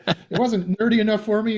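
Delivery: a laughing, hammed-up nerdy boast; mock nerd. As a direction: play the nerd pushing up his glasses. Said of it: "he says, he pushes glasses up his nose".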